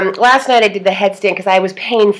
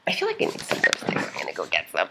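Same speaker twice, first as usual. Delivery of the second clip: whispered aside; 12 semitones lower, 11 dB quieter, faster than usual. Real, not acted. Muttered aside to herself.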